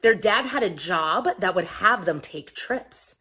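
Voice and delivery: plain reading voice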